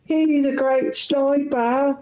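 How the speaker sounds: put-on high-pitched British child; mock British kid, high and gushing; little kid with British accent. Little kid praising his sniper hero to the German, high British voice. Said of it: "The little kid's like".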